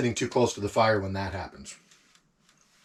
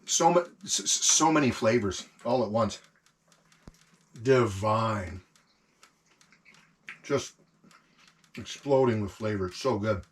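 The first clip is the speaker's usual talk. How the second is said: mouth full; 3 dB quieter than usual. Real, not acted. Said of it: "I'm gonna talk with my mouth full".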